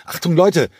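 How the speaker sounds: high, loud call through the cabin; urgent shout to the whole plane. Acted. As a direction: shout it down the aisle to wake everyone. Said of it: "rief lautstark durch den Flieger".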